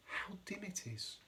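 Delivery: whispered into the dark water; murmured. A narrator's reading. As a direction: hush it like a nervous sea anemone. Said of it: "murmured the sea anemone".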